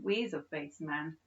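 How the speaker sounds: ordinary delivery, nothing marked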